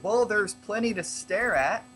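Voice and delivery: shrugging nonchalance. Acted. Player shrugs off a remark in character, nonchalant. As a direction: toss it off with a shrug. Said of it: "shrugs and is like".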